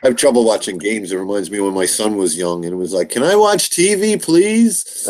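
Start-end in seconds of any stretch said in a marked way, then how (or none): 3.1-5.1 put-on high pleading kid voice; childlike begging. Mother recalls her young son begging for TV. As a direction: beg like a small child.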